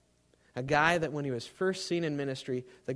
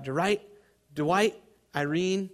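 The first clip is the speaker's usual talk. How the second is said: higher and slow, calling the names; crying out; 4 semitones higher, 4 dB louder, much slower than usual. A narrator's reading. Dying preacher cries out to departed children, yearning. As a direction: call out to the children who passed on. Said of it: "he cried out".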